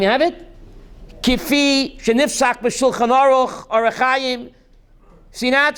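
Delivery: ordinary talk, nothing marked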